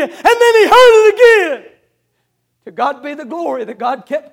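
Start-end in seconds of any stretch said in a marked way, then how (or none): 0.0-1.7 preaching. Acted. Sermon climax.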